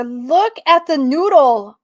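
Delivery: loud, slow, delighted cry; excited gushing. Real, not acted. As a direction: gush loudly, stretching out the words. Gushes over cute card creature.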